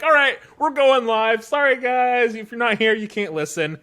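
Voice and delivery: loud, high rushed announcement; hurried announcer. Retold or imitated. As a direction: cut the feed like a flustered host. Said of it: "they're just like".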